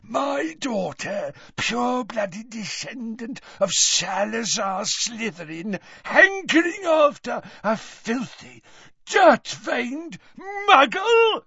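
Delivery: high hiss building to a shriek; mounting fury. A narrator's reading. Father denounces his daughter, mounting rage. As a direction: start slow and high, erupt into shouting.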